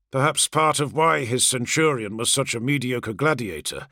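plain reading voice

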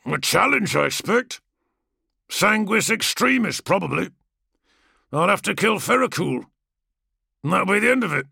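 swaggering gladiator, barely hiding scorn; lightly voiced brash warrior. Kargos boasting.

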